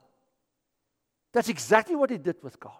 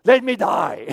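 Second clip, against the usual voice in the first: loud, laughing mock despair; mock sulking Jonah; 3 semitones higher, 9 dB louder, slower than usual. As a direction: whine it dramatically through a laugh. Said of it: "God takes the bush away".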